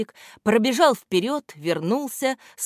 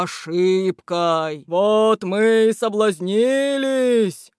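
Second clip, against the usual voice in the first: slow, louder month-character voice; embarrassed January; 3 semitones higher, 5 dB louder, slower than usual. A narrator's reading. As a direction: confess sheepishly as the winter month. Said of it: "смущённо добавил январь".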